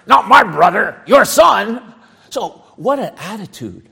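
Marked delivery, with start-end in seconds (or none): 0.0-1.9 mock sneer at "that son of yours"; hammed-up resentful elder brother. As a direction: spit out the brother's name in contempt. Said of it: "he said".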